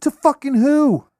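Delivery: loud, high-pitched disbelief; incredulous outburst. Real, not acted. Challenging a friend who says they're selling, loudly incredulous. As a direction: shoot it way up, loud and drawn out.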